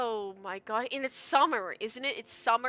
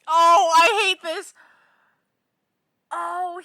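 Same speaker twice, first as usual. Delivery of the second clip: loud pained cry; upset outburst; 8 semitones higher, 15 dB louder, slower than usual. Real, not acted. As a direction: wail it out in dismay. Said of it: "shaky crying voice".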